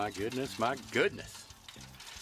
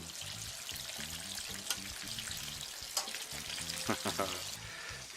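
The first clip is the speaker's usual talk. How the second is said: soft chuckle; 2 semitones lower, 8 dB quieter than usual. Real, not acted. Laughing at the frying chicken.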